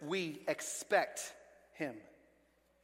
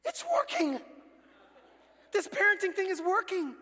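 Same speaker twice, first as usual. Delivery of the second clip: giddy parents; 11 semitones higher, 4 dB louder than usual. Retold or imitated. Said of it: "we're like".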